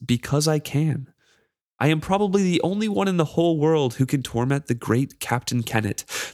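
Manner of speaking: a spiteful charm relishing his torment; cruel mocking charm. Reads the charm's cruel taunts.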